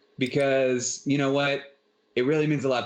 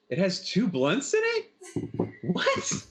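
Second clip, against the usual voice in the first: higher, drawn-out feigned disbelief; mock surprise; 9 semitones higher, slower than usual. Player showing off a mason jar to friends, feigned surprise. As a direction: play up the astonished discovery.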